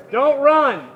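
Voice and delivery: high, loud command; urgent shout. Real, not acted. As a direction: yell it urgently. Urgent warning to friend.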